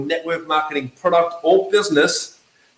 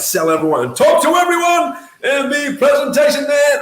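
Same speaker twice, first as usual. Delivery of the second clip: high, shouted salesman hype; outgoing competitive seller; 8 semitones higher than usual. Acted. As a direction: play a pushy, over-keen presenter. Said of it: "Maybe you're an outgoing, red competitive person".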